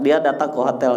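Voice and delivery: ordinary talk, nothing marked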